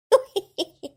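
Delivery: a short giggle, pleased with herself; coy giggle. Acted. Wife giggling to her husband about birthday gifts.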